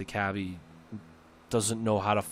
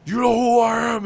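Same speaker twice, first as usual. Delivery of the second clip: much higher and louder, an angry put-on yell; yelling back; 12 semitones higher, 9 dB louder than usual. Caller yells back at the prank guy in a high, loud put-on voice. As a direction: bellow it back in a silly voice. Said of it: "yelling at the guy back".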